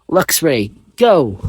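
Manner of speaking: trainer call. A narrator's reading. Trainer calling out Pokemon.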